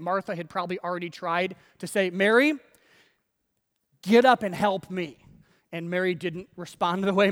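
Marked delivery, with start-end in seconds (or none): 2.1-5.2 loud call to a sister; mock bossy summons, raised voice; acted exasperated order. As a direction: call the name, pause, then demand loudly. Martha ordering her sister.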